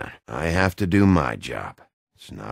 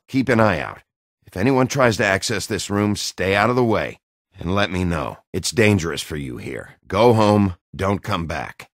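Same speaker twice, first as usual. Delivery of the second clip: raised, higher voice pressing them to leave; urgent orders; 6 semitones higher, 5 dB louder than usual. Acted. Detective urging the witness to leave, loud and urgent. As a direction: push the words out loud and urgent. Urgently sending someone away from danger.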